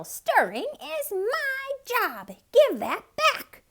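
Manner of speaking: very high, loud squeaking squirrel; squeaky demand. Acted. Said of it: "squeaked the squirrel".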